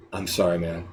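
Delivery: very low, soft condolence; hushed, sombre worker; funereal apology. Acted. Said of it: "It feels like a funeral".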